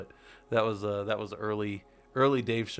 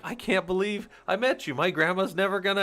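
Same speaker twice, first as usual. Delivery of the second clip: starstruck cop; 8 semitones higher, 5 dB louder than usual. Retold or imitated. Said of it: "the cop's like".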